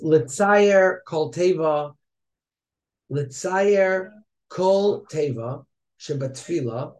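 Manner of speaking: Litzayer Kol Teva read very slowly, word by word; slow deliberate reading. Shown as a demonstration. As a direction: spell each word out slowly in your mind. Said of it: "reads the text slowly, deliberately".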